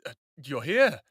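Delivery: breathless surprise, voice jumping up high; surprised. Acted. Man startled to find his returning lover here, surprised. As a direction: caught off guard, stammering out her name. Sees Alexa arrive unexpectedly.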